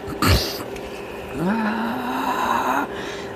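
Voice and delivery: long high held squeal; imitated high scream. Recalling my scream, a long high squeal.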